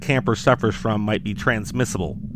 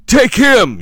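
high, loud desperate yell; 13 semitones higher, 10 dB louder than usual. Acted. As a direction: scream it in a panic. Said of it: "you yell".

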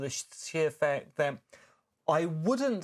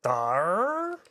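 a hammed-up pirate "Dar?", higher and louder; pirate accent; 5 semitones higher, 5 dB louder than usual. [name] told to drop the accent, answering in pirate accent. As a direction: slip straight back into the pirate voice, puzzled. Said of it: "that atrocious accent".

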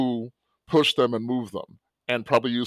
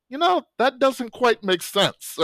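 skeptical; 12 semitones higher, slower than usual. Retold or imitated. Student's inner voice, doubting.

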